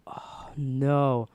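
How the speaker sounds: breath-only retold "oh no"; whispered dread. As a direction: whisper it as the trouble sinks in. Retold dread after being blamed.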